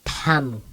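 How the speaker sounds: Tamu with an H injected after T; aspirated T. Shown as a demonstration. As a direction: puff air on the T of Tamu. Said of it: "injecting an H letter".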